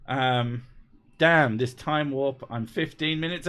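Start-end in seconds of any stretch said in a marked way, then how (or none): none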